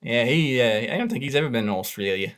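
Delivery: put-on broad Aussie accent, chatty; Australian accent. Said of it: "such a brilliant Australian accent".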